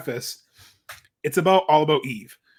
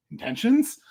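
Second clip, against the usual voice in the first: puzzled echo; 8 semitones higher than usual. Retold or imitated. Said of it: "And she's like".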